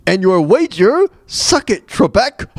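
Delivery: fake-praise like a game show host; imitating Trebek. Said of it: "Trebek's like".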